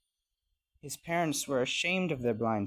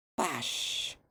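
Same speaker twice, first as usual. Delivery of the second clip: splash said as a sound effect. A narrator's reading. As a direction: let the word burst and rush out like water. Narrator imitates a dive into water.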